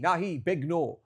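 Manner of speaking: firm no. Shown as a demonstration. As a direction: push them away with a loud big no. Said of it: "You've gotta go".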